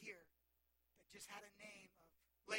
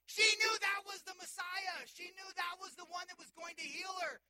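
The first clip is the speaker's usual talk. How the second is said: full-force preaching; 7 semitones higher, 10 dB louder than usual. Acted. Preacher peaks loudly.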